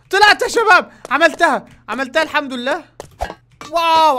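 high, loud victory cry; triumphant shout. Real, not acted. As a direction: celebrate making it. Finally climbed up.

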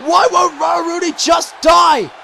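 normal talking voice

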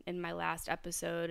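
ordinary talk, nothing marked

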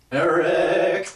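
loud, high, drawn-out Eric; different tone. Acted. Friend asks for another tone, drawn-out high shout. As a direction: stretch Eric into a big high holler. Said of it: "Say that in a different tone".

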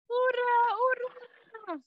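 very high little cheer; high bee cheer. A narrator's reading. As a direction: cheer like tiny bees. Bees cheer.

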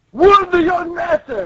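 high urgent guard voice; alarmed guard. Acted. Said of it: "the guard's like".

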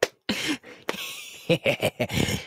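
high, breathy, creepy giggle; long clown titter; delighted cackle. Acted. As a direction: giggle with greedy glee. Clown pleased to get candy.

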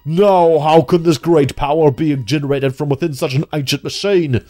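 loud, grand villain line building to a shout; booming, drawn-out final cry of the beaten foe; dramatic villain speech. Acted. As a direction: ham up a defeated boss's last speech. Reading boss's dramatic defeat line.

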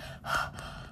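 imitating the huff of arriving winded; a tired breathless exhale; mock puff of breath; winded sigh. Acts out arriving at work winded.

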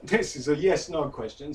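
ordinary delivery

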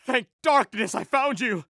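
ordinary talk, nothing marked

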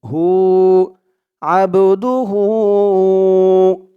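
slow chant with a long drawn "হু"; one-alif elongation. Shown as a demonstration. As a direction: stretch the final vowel of "আব দু হু". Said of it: "এখানেও এক আলিফ টান হবে".

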